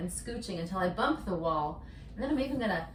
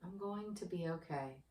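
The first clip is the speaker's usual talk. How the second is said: softer, gently offered self-reassurance; soft mantra; 3 semitones lower, 10 dB quieter than usual. Shown as a demonstration. Yoga teacher models the calming mantra for the viewer, softly. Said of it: "to bring in a mantra, very simple".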